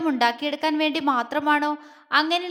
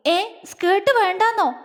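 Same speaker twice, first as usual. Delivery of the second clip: startled, higher-pitched question; alarmed; 7 semitones higher than usual. A narrator's reading. Wife hears her skirt is being banned, flustered. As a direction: pitch jumps up in flustered disbelief. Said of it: "Jwala വെപ്രാളത്തോടെ".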